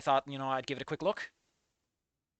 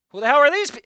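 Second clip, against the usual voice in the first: higher and louder, cut off mid-word; hammed-up bewildered yell; mock outraged shout; 9 semitones higher, 12 dB louder, faster than usual. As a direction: yell it before breaking off laughing. Outburst at concept art.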